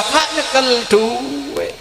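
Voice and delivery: high, long drawn-out mock whine; imitated pleading. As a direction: stretch out a pitiful plea. Acted plea for money. (menirukan rengekan minta uang)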